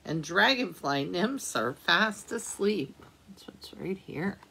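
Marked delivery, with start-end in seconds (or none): none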